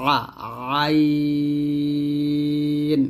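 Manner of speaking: আইন drawn out long, sung from the throat; drawn-out Arabic ʿayn. Shown as a demonstration. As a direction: stretch আইন like a recitation. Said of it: "এরকমভাবে". (টেনে সুর করে আইন)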